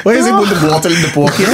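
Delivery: cracking up while teasing the accent; giggly mock question, fast; laughing. Real, not acted. Mocking Statia phrasing, laughing.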